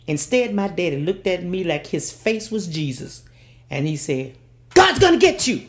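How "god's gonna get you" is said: high, loud ominous threat; daddy's warning. Retold or imitated. Father threatening his daughter with God, high and loud. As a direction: warn her like a stern preacher daddy.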